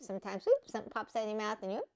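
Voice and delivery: ordinary talk, nothing marked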